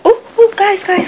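high, loud burst; real excitement. Real, not acted. Vlogger alerting viewers as the boxers open, excited yelp. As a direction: call out in sudden excitement. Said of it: "I'm excited".

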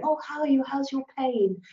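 lightly put-on sympathetic enquiry; group member greeting. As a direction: imitate someone fussing over a friend's pain. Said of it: "people meeting and they're going".

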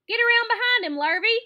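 high, loud farmer giving orders; calling out orders. A narrator's reading. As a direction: call it across the yard to the hired man. Farmer calls orders to hired man.

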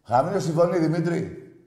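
sharp, loud telling-off; irritated ordering. Real, not acted. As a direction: order the caller about, exasperated.

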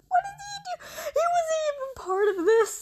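very high loud sobbing shout; crying shout. Real, not acted. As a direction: cry out over the unfair arrest. Innocent friend arrested.

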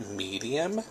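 Medium said low and soft, tiny; small voice for medium. Shown as a demonstration. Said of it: "you can see the scale difference".

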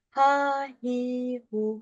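"Ha, hi, hu" held level with a sharp i; plain Turkish h in "Ha, hi, hu"; wrong reading, "hi" with a full front i; wrong Turkish vowels. Shown as a demonstration. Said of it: "Bu da yanlış".

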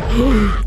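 sharp audible intake of breath; quick startled gasp; surprised gasp. Real, not acted. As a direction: gasp at a sudden discovery. Sees another tape.

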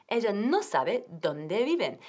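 ordinary delivery

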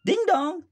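high sing-song ding dong; doorbell. Acted. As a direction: chime it like a doorbell. Narrator voices doorbell.

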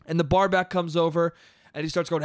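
ordinary talk

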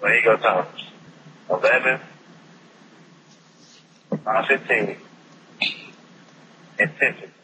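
over the phone. Real, not acted. Friend heard on phone call.

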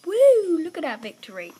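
high, loud whoop easing down; celebrating. Real, not acted. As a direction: whoop over the win. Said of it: "I'm just celebrating".

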